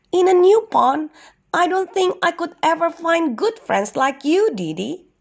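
high-pitched storybook duck voice; worried young duck. Acted. Duck fears losing his turtle friend, high worried voice. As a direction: pitch it well up, fretting gently.

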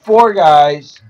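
high, loud gleeful cry; excited shout. Real, not acted. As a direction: shout it with glee. Excited cry after an explosion.

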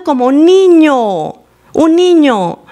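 "niño" drawn out, high and falling; emphatic stress. Real, not acted. Preacher marvels at the slave's voice, stressing "niño". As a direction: lean long and high on "niño".

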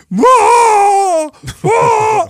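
high, loud wailing cry; mock loud sobbing wail; imitated wailing sob. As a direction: wail like a grown man breaking down. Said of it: "starts crying".

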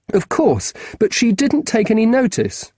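lightly voiced keen schoolboy; a boy asking a grown-up a favour; boy's voice. Marcus voiced higher.

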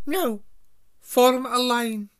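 high, loud, slow command; a strict professor giving orders; stern teacher voice. Acted. McGonagall ordering first years.

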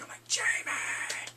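voiceless stage whisper of delight; whisper-scream the name like a fan; excited whisper. Retold or imitated. Mock-thrilled reaction to Jaime returning.

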